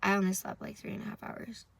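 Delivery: ordinary talk, nothing marked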